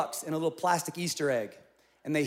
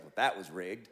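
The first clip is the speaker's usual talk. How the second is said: deadpan; 10 semitones lower, 3 dB quieter than usual. Retold or imitated. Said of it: "And I was like".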